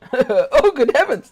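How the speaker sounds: high, loud startled toff; a posh gentleman's startled cry; posh shriek. Acted. Gamer shrieking like a startled posh gentleman.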